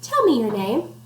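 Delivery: usual speaking voice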